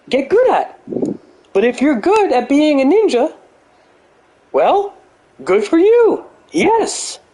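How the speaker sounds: high-pitched mock Jordan Peterson holding forth; Jordan Peterson impression. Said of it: "I can do a Jordan Peterson impersonation".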